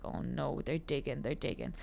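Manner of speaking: frightened under-the-breath mutter; panicked hush. Retold or imitated. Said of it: "And I was like".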